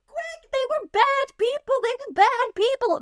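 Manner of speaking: high mock panic of little orphans; frightened kids. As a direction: warn urgently like a scared child. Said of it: "the kids get to the house".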